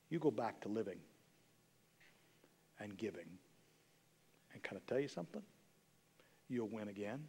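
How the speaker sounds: low, very soft reassurance; intimate, earnest pastoral comfort; hushed encouragement. Real, not acted. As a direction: let it sink almost to a murmur, pausing between phrases. Preacher quietly encourages the downhearted.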